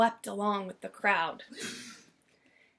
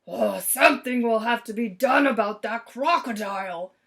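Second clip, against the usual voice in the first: stormed; 3 dB louder than usual. A narrator's reading. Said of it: "stormed Mister Grumps".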